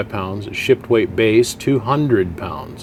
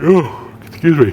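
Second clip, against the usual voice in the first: yawning; 6 semitones higher, 4 dB louder than usual. Real, not acted. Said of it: "I don't know why I'm yawning".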